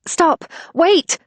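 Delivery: high, loud shouts; shouting. Acted. Narrator shouting after the women rushing out. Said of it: "I shouted".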